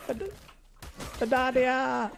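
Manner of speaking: high goofy exclamation; silly shout. Real, not acted. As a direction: yell it playfully.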